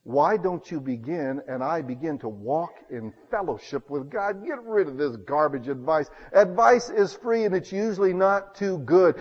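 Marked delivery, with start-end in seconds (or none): none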